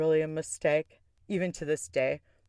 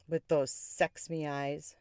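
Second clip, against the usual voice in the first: ordinary talk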